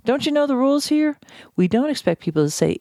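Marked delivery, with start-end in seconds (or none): 0.0-1.2 lightly hammed-up exasperated rebuke; mock angry scolding. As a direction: play the irritated person barking orders at someone. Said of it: "are expecting people to, again, to say that".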